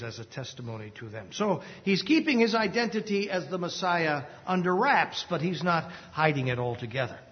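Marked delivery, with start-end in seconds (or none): 0.0-1.3 much softer and quicker, tossed off; quick quiet aside. Shown as a demonstration. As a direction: murmur the phrase fast as a side note. Phrase repeated as margin note.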